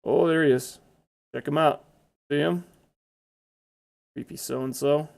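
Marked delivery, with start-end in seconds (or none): none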